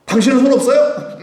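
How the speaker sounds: high, loud protest; mock exasperated wife. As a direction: snap back like a fed-up spouse. Acted wife fed up with husband. (짜증 난 아내처럼 쏘아붙이는 말투)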